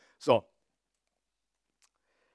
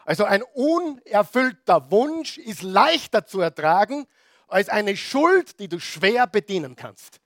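full-force preaching; 5 semitones higher, 4 dB louder than usual. Acted. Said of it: "preaching at full force".

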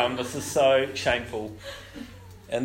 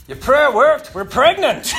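much higher, louder excited announcement; joyful news; 10 semitones higher, 9 dB louder, slower than usual. Retold or imitated. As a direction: burst out with the happy news. Friend's joyful news on phone.

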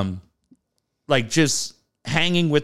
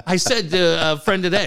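normal talking voice

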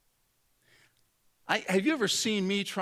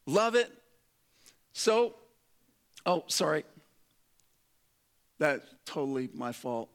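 ordinary talk

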